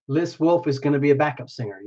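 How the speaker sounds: ordinary talk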